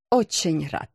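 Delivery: low, unenthusiastic greeting; mildly glum politeness; sour pleasantry. A narrator's reading. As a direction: say it with a faint sour smile. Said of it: "Кисло улыбнулся Янис".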